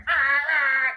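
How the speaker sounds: loud, high blaring honk; imitated car horn. Driver mimicking the honk at a green light, loud high blare. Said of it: "people that honk their horns".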